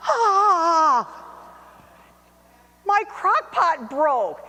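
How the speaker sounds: loud, slow mock crying wail. Cook discovers the broken crock pot, mock wailing. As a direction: wail it like a heartbroken child. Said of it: "And then I went".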